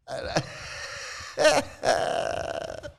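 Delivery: high, breathless laugh; real wheezy laugh; leftover laughter. Real, not acted. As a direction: let the last laughs spill out. Still laughing after the jokes.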